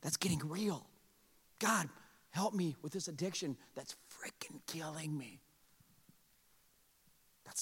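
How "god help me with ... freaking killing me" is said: unvoiced desperate whisper to God; whispered prayer. Pastor voicing a struggling believer, whispered plea. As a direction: whisper it like a private confession.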